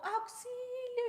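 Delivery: high, slow cries for help; hammed-up fake screams of help; mock screaming assistant. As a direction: shriek for help like a schemer faking an attack. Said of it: "es gritar".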